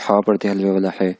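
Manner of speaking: ordinary talk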